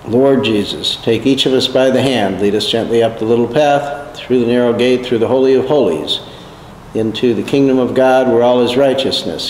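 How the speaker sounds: ordinary delivery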